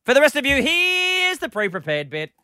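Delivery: big, bright host intro; radio announcer. Acted. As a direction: sell it like a radio promo.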